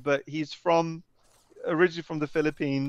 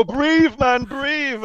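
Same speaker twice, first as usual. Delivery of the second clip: high, raised-voice mock urging; urgent coaxing; 11 semitones higher, 5 dB louder, much slower than usual. Host to awestruck fan outside the pub, urging. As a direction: call out to snap someone out of awe.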